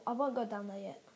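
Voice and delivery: ordinary talk, nothing marked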